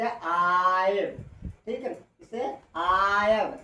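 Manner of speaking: आयव said slowly and firmly, aa-yuv; model pronunciation. Shown as a demonstration. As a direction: stretch out आयव like a teacher. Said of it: "आई comma वी को आयव कहते हैं". (आयव को धीमे और साफ़ बोलो)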